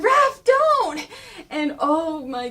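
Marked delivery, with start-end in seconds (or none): 0.0-1.1 high, loud mock warning cry; Leo's urgent shout. Leo calling after Raph, loud urgent shout. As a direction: yell to stop your brother. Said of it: "Leo's behind him and goes like".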